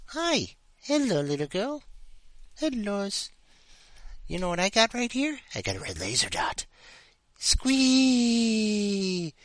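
sweet pet talk to a kitten; talking to the cat. Real, not acted. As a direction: coo at the little cat to get her playing.

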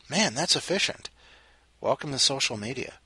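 normal talking voice

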